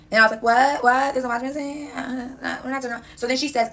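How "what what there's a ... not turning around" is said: raised, incredulous double what; retelling a shocked phone reaction, lightly raised; imitating her own shock. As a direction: replay the call in mock disbelief, higher.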